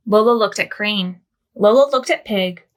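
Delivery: plain reading voice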